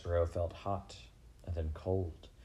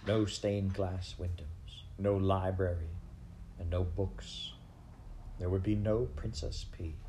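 ordinary delivery

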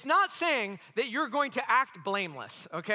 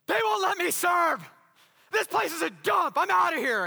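high, loud indignant rant; angry church lady; 10 semitones higher, 7 dB louder than usual. Retold or imitated. Church lady ranting at the homeless shelter, high and loud angry. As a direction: storm off in a huff, high and loud. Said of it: "And she's all".

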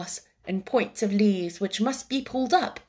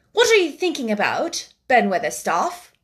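put-on Colin, curious boy; 5 dB louder than usual. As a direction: ask like an imperious young master. Voices Colin in the story.

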